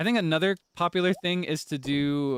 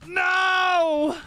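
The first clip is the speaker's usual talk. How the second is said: sudden high anguished shout of No; loud dismayed No; 6 dB louder than usual. Real, not acted. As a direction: cry out No in real dismay. Gamer's crop dies suddenly.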